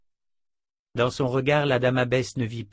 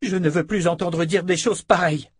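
higher-pitched, indignant outburst; cried out, moderately louder; angry cry; 8 semitones higher, 6 dB louder than usual. A narrator's reading. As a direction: protest sharply with the pitch raised. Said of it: "cria-t-elle".

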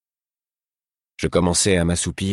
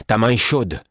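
ta main est chaude shouted higher and louder; alarmed cry; 6 semitones higher, 5 dB louder than usual. Acted. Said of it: "en m'écriant".

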